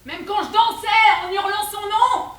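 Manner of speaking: high, very loud wail; full-voiced, high-pitched lament; despairing yell. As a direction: cry it out in lovelorn despair. Despairing climax of her confession.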